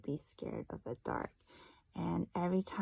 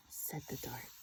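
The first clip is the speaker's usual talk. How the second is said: hushed, breathy storybook whisper; whispering the dark's words to first graders; spooky whisper; 9 semitones lower, 12 dB quieter than usual. Acted. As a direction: whisper it like a secret. Narrator whispers the dark's tag.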